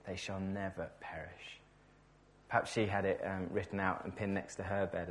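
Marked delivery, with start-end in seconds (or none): 0.1-1.6 hushed echo. Shown as a demonstration. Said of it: "preacher repeats the promise".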